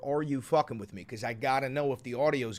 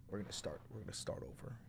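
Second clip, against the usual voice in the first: unvoiced hushed aside; whisper; 5 semitones lower, 16 dB quieter than usual. Real, not acted. Restarting the recording, whispered aside. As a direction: breathe it out.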